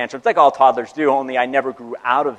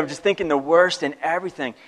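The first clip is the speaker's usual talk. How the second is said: normal talking voice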